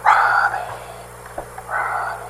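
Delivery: drawn-out whispered name, fading away; God calling softly. Retold or imitated. Preacher as God calling Ronnie, hushed whisper. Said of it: "No matter what level he says it".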